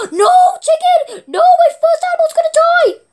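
high, loud panicked cry with laughter; panicked shout. Real, not acted. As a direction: yell in half-laughing panic. Panic as chicken drowns.

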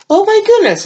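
very high, delighted "Oh, my goodness"; thrilled gasp. Real, not acted. Overwhelmed by more gifts.

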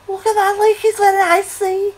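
very high, loud mock Scouse; shrill-pitched, shouty fake Scouse voice; bad Liverpool accent. Shown as a demonstration. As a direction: squeal it in a hammed-up Liverpool accent. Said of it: "That's my shit accent, um, of, um, Liverpool accent".

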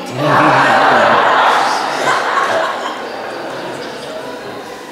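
breathless airy laugh; real laugh. Real, not acted. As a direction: let the laugh run out on air. Laughs at air conditioner sign.

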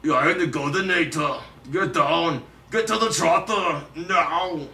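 put-on forceful Austrian-accented action hero; action-hero impression. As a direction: punch out commands like an action hero. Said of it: "Do you do any other impressions?".

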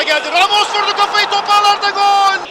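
screaming football commentator. Real, not acted. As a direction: scream the goal call. Commentator screams header goal.

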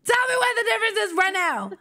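high, fast yelled demand; worked-up, high-pitched insistence; escalated demand. Real, not acted. Repeats demand, escalating the argument.

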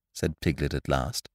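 ordinary delivery

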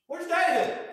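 sharp high call for a missing son; king barking across the dinner table; angry demand. Retold or imitated. King demanding of his son at dinner, sharp high call. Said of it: "he goes".

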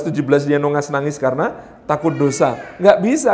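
ordinary talk

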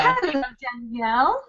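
put-on slips into an American accent on the greeting; a breezy put-on American hello. Greeting her interviewer Danielle in an unplanned American accent. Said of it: "why am I doing an American accent?".

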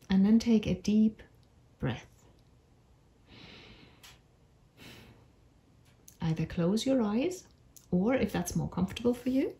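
unhurried meditation voice with long pauses; measured storytelling for a calming visualisation; guided meditation. Acted. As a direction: guide them gently through an imagined walk, phrase by phrase. Leads a guided imagery meditation.

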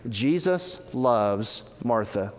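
ordinary talk, nothing marked

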